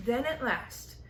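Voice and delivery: ordinary delivery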